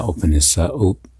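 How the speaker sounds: usual speaking voice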